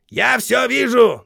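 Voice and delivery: high, slow, loud threat; shouting drill sergeant. A narrator's reading. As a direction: bellow a warning at the ranks. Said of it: "добавил сержант". (угрожающий окрик)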